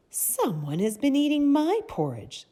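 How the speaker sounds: high, sweet mother bear voice, projected; high-pitched, loud, sweet storybook bear; sweet Mama Bear. A narrator's reading. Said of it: "in her sweet, soft voice".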